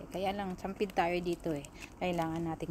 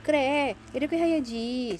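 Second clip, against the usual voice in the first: mock Korean phrase, higher and louder; Korean accent; 7 semitones higher, 7 dB louder, slower than usual. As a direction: imitate the Korean lady. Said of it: "sabi niya".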